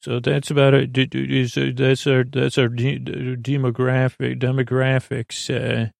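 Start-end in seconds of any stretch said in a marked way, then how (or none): none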